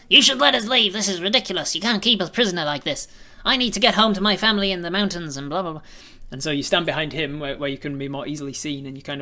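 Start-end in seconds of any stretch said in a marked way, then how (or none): 0.1-5.8 high, loud, indignant gnome voice; a furious little gnome demanding to go home; angry rock gnome. Acted. Rock gnome yelling at guards to let him leave, angry high voice. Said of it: "an angry rock gnome guy going".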